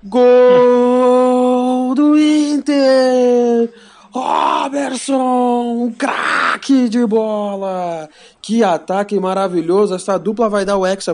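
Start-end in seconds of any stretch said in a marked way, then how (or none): 0.0-5.8 long drawn-out goal scream; explosive goal commentary. Acted. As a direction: stretch the goal call out. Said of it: "narrarão algum gol".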